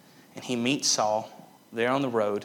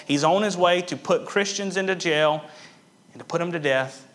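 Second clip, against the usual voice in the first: ordinary talk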